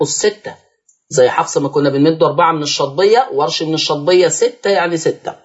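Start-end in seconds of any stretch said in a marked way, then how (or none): none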